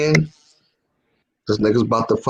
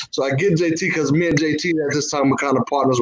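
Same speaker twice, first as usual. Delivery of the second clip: normal talking voice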